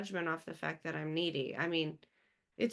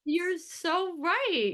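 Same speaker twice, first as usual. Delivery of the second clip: slow, much higher, stretched-out praise; drawn-out emphatic agreement; 10 semitones higher, 6 dB louder, much slower than usual. Real, not acted. Host to guest, agreeing with strong feeling, drawn-out and high. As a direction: lean hard into agreeing.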